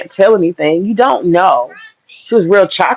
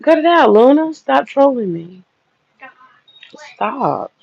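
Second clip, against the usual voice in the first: hushed scolding; 6 semitones higher than usual. Real, not acted. Mother shushing daughter on stream.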